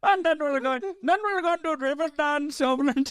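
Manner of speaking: high-pitched mock Indian-Irish accent. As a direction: imitate a botched Irish accent that comes out Indian.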